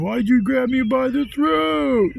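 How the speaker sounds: high, loud, drawn-out wail; mock crying. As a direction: imitate a drunk bawling like a little child. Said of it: "crying like little children".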